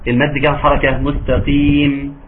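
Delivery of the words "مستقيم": مستقيم with long stretched ee and stop; pausal madd. Shown as a demonstration. Teacher showing the madd at a pause, slow. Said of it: "أقف عليها بكم مد".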